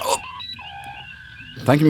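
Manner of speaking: ordinary delivery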